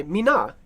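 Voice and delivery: "Mina" said mee-NAH, weight on the final syllable; shifted accent. Shown as a demonstration. Telling the character to say her name differently, "Mina" stressed last. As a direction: put the accent on the end of "Mina". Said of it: "just say your name differently".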